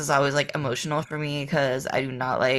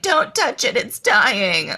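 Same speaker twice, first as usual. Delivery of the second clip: pleading hippie voice; 6 semitones higher, 4 dB louder, much slower than usual. Retold or imitated. Imitating Portland tree lovers.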